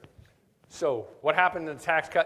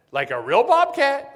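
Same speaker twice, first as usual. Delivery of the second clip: high, loud and slow, in disbelief; incredulous question; 12 semitones higher, 10 dB louder than usual. Retold or imitated. Caller hearing about a wild bobcat, asking high and loud in disbelief. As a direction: ask it as if you can't believe it. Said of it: "She goes".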